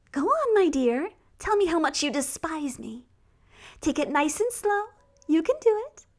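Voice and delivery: taunting witch voice. A narrator's reading. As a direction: coax him cruelly like a witch. Witch taunts him sweetly.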